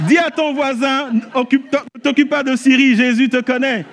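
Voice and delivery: fervent preaching. Acted. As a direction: rouse the crowd and make them repeat it. Preaching at full force.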